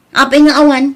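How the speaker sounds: loud, indignant why-not; sharp parental scolding; exasperated outburst. Acted. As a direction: push back hard, raising the voice. Parent scolding over rice only.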